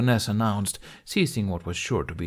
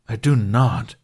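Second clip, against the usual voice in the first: loud, sharp I do not; indignant denial; 8 dB louder, slower than usual. Acted. Toothless denying he sounds human, loud and indignant. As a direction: snap back offended.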